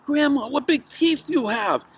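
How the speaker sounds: put-on high, loud childlike astonishment. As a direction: exclaim high and loud at the sight. Girl alarmed by wolf's teeth.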